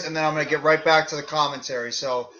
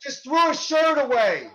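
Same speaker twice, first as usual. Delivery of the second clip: wrestling commentator; 8 semitones higher than usual. Acted. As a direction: shout the big moves like live TV. Live play-by-play of match.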